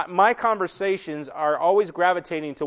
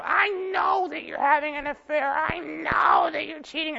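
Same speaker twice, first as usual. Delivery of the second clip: high-pitched accusing wife, lightly voiced; shrill jealous wife, imitated not yelled; mock screaming wife; 11 semitones higher than usual. Wife accusing husband of cheating in parking lot, high imitated accusing voice. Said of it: "she was screaming at him".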